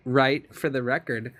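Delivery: usual speaking voice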